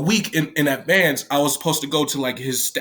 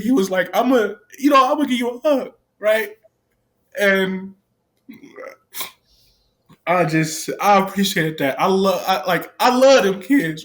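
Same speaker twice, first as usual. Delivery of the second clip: real tearful, raised and strained; crying; 6 semitones higher than usual. Real, not acted. Staff member crying while praising the kids he left. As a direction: speak through real tears. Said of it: "y'all see me cry right now".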